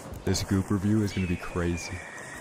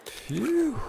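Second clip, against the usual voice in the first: relieved phew. Real, not acted. Relief after loot.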